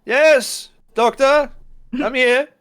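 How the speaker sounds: high, loud answering voice; Doctor Gray answering from afar, loud and high; distant reply. Acted. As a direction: call back from another room. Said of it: "you sorta hear, like, a".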